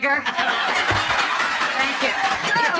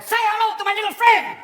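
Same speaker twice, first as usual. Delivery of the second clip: put-on higher, louder Cuban-accent Pacino; Scarface quote; 8 semitones higher, 5 dB louder than usual. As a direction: bark the quote like Tony Montana. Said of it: "Also, you had".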